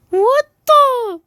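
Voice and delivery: high, loud, stretched yelp; shocked yelp. Real, not acted. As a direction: yelp it in disbelief. Shocked reaction in game.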